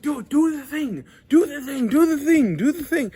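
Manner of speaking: high, very loud, repeated urging; mock yelling. Stranger hounding him outside the gym, hammed-up yelling. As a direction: imitate an over-eager gym stranger shouting you on.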